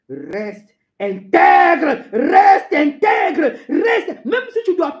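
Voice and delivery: loud, stretched cries climbing in pitch; shouted exhortation. Acted. Preacher urging the beloved to stay intact, shouting.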